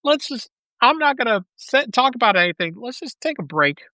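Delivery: ordinary talk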